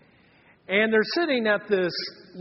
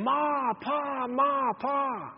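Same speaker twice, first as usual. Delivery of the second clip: put-on kids yelling; 6 semitones higher, much slower than usual. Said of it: "And they're yelling".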